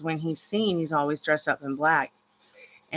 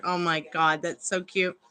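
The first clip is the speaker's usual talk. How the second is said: normal talking voice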